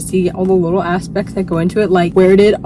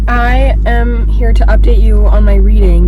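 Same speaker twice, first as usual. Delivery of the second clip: raised, louder voice over the car noise; talking loud in the car; 7 dB louder than usual. Real, not acted. Vlogging from the car, calling over the noise, loud. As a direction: push the voice out over the engine and music.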